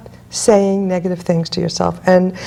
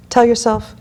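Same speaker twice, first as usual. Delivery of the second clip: normal talking voice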